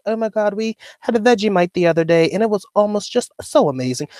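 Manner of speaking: high-pitched imitation of a posh accent; mock posh accent. Streamer imitates a Filipina friend back from Australia, mock posh accent. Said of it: "her accent was so posh now".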